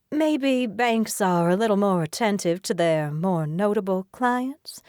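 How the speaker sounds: lightly voiced Southern belle accent; smug Southern drawl, taking her time. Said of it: "she drawls in a strong Southern accent".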